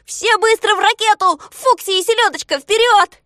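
very high, loud urgent command; команда Знайки. A narrator's reading. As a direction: shout orders to get everyone moving. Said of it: "Знайка скомандовал коротышкам". (громкая срочная команда высоким голосом)

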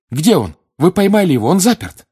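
high, loud, frantic questions; urgent shouting. Acted. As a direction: demand answers at the top of your voice. Boy's excited outburst continues. (взволнованный крик)

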